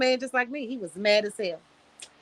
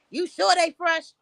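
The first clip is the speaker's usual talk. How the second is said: rude customer; 5 semitones higher, 4 dB louder than usual. Retold or imitated. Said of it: "This how they talks to me".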